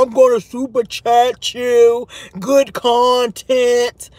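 slow, put-on disguised voice; mock voice changer. Host mimicking the troll calling into another show, disguised voice. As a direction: imitate a caller drawling through a voice changer. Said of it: "with the voice changer".

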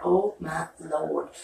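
slow, lingering, lovestruck sigh of words; swooning. Real, not acted. Gushing to viewers about Joe's accent, slow and swooning. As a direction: melt into it slowly.

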